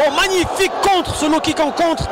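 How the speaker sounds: high burst of excitement; excited. Real, not acted. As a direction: jump up at the big counter. Commentator reacts to counter.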